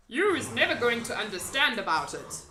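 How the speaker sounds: loud, folksy friendly giant. A narrator's reading. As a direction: play the big kind giant.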